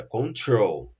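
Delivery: "control" with the second syllable, trol, stressed; stress on last syllable. Shown as a demonstration. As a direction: lean on the end of "control": con-TROL. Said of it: "essa última sílaba é a tônica".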